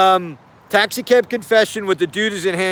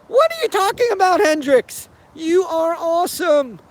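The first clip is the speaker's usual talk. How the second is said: delighted gushing; 9 semitones higher than usual. Real, not acted. Gushing over Hendrix's answer.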